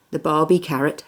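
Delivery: plain reading voice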